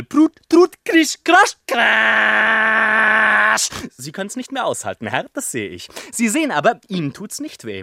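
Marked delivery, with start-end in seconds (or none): 0.0-3.7 screeching fiddle noise, higher and louder, ending in a shout; fiddle crash. Acted. Fiddler wrecking his tune for the gentlemen, screeching loud. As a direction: scrape the strings into a shouted crash.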